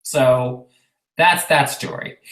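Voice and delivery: ordinary talk, nothing marked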